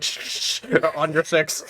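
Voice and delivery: hissed static bursts around the words; radio static. Acted. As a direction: imitate a crackly radio transmission with shushing noise. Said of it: "I like their static in between".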